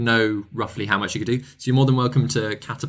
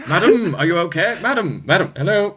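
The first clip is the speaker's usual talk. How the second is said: high, theatrical indignant paladin; paladin performing; 7 semitones higher than usual. Acted. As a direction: declaim it for the whole group to hear. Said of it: "perform it to the group. He's like".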